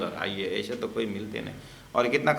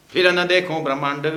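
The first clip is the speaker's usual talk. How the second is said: acted meaningful variant, louder; acted with a hint; 5 semitones higher, 7 dB louder than usual. Shown as a demonstration. Preacher acts the altered line so the congregation gets the joke, louder. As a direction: act the line so its hidden meaning shows. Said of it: "मेरे acting से समझ लेना".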